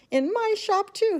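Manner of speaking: high, loud yell of pride. A narrator's reading. Shopkeeper yelling proudly that it happened in his shop, high and loud. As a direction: crow it to the whole shop. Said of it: "he yelled".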